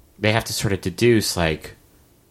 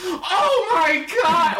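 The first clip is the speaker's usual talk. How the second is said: high, drawn-out cracking yell; voice cracking scream; much slower than usual. Retold or imitated. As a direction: let the voice crack on a slow scream. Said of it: "voice cracking, nerd-ass shit".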